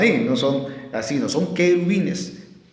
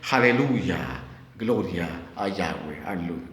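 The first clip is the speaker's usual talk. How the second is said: quiet prayer; 12 semitones lower, 9 dB quieter than usual. Real, not acted. Oración susurrada en medio del sermón.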